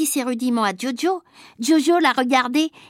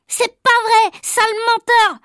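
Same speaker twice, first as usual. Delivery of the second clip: put-on higher, louder angry child yell; shouted; 8 semitones higher, 5 dB louder than usual. Clotaire yells at Agnan, loud and high. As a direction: shout him down. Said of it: "a crié Clotaire".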